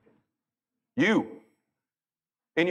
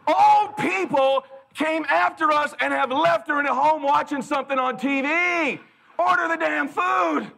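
loud, high, slow exasperated rant; 12 semitones higher, 4 dB louder, slower than usual. Retold or imitated. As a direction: rant at her to order. Husband venting at slow order.

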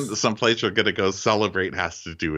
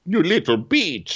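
high, slow exaggerated scolding; hammed-up high-pitched outrage; mock indignation; 11 semitones higher, 4 dB louder than usual. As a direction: draw out the insult in a put-on high voice. Joins in acting Andrea's outburst.

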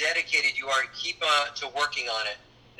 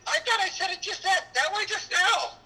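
mocking director voice. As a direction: mimic a fussy director, pitched way up. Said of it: "It's like".

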